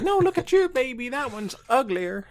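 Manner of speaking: shrill, loud hammed-up adult comeback; mock adult retort. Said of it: "and then the adult would be like".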